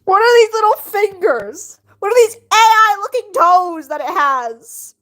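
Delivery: high, loud, incredulous questioning; loud disbelief. Real, not acted. Streamer baffled by the drawing's fingers and toes, loud disbelief. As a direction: exclaim in appalled amusement.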